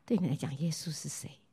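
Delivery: hushed whisper, asking close in; whispered question. Acted. Preacher asks the crowd intimately.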